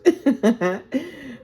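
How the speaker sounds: sudden high, loud laugh; amused laugh. Real, not acted. Reader laughing at her own Spanglish remark, high and loud. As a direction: burst out laughing at yourself.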